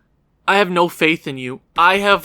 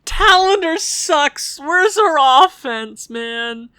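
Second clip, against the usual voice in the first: frustrated groan; 6 semitones higher, much slower than usual. Real, not acted. Frustrated complaint.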